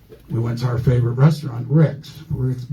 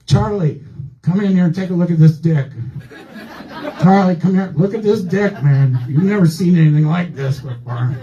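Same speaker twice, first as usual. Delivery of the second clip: higher-pitched mock alarm; retold urgent calling out; 6 semitones higher than usual. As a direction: act out an astonished doctor calling his assistant over. Retold doctor calls in his assistant.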